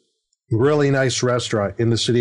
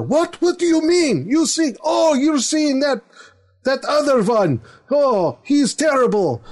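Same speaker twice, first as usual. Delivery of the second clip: put-on much higher, shocked accented father; outraged father; 14 semitones higher than usual. Taxidermist father hearing his daughter loves another, in a high, shocked accent. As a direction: play the scandalized dad, shrill with disbelief. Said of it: "So, so I acted like the father".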